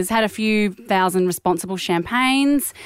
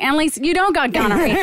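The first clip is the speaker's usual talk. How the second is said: put-on a light American doctor accent on "Annalise, you don't got gonorrhea", a bit higher; 7 semitones higher than usual. Asked by her friend to put on the doctor's American accent. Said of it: "Can you put the accent on?".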